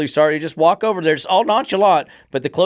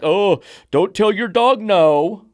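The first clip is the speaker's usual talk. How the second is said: mocking quote; 5 semitones higher, 5 dB louder, slower than usual. Mocking soft-trainer advice.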